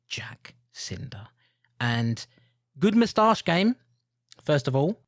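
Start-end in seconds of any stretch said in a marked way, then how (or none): 0.1-1.3 hushed whispered name, slow and drawn out; dramatic whisper. Acted. Podcast host unveiling the movie's sheriff, dramatic whisper. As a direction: breathe the name like a big reveal.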